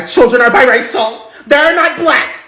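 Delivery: high, loud haughty snap; haughty snob. Acted. Karen scolding Michael, high, loud and haughty. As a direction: look down your nose and correct him. Karen insists kids are biracial.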